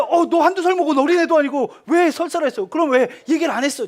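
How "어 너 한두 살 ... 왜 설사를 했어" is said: high, whining pretend scold; mock scolding. Pretend dad scolding his toddler for diarrhea, high whiny scold. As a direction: scold him like a fed-up parent. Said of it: "제가 이랬을까요?".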